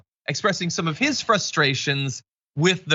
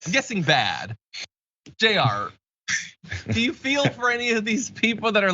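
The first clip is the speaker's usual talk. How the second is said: usual speaking voice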